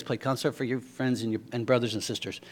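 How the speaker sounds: put-on a soft, light Cuban accent in broken English. Preacher quoting his Cuban friend again, a light accent. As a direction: gently echo a Cuban friend's halting English. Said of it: "That's what he says".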